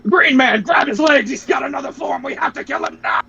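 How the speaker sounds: high, frantic shouting, fading to a strained cry; frantic yell. Retold or imitated. Vegeta frantically yelling at Piccolo to grab Frieza, high panicked shout. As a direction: scream orders to grab him.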